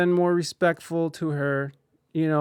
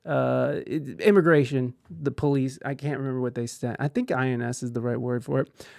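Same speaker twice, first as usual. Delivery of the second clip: usual speaking voice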